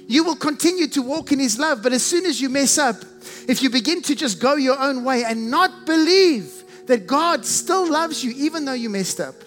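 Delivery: normal talking voice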